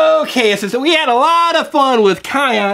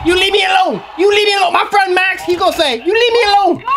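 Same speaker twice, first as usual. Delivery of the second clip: high-pitched indignant cartoon rabbit; Snowball; 6 semitones higher, faster than usual. Rabbit with friend Max warning Kion off, indignant. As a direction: squawk back as a feisty toy rabbit. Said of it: "to leave Snowball alone".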